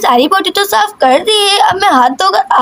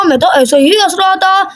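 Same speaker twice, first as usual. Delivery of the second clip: ordinary delivery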